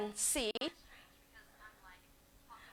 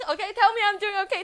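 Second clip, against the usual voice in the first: higher, louder, laughing tell me I'm doing okay; laughing plea; 9 semitones higher, 6 dB louder, faster than usual. Real, not acted. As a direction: giggle through the plea for reassurance. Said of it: "I'm feeling a little bit insecure".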